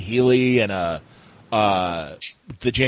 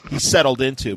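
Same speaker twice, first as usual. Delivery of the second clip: ordinary talk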